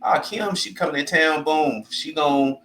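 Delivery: high, sing-song imitation of gossiping relatives; mock gossip voice. A friend mocking relatives who gossip about her arrival, high sing-song voice.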